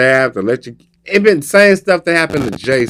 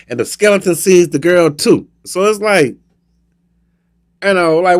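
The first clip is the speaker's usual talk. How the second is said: normal talking voice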